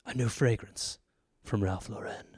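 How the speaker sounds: deep, hushed, dramatic ad announcer; low and soft, like a moody perfume ad; perfume ad voiceover. Pretend. Mocking a perfume commercial, deep hushed dramatic voiceover.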